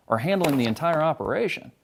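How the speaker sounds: usual speaking voice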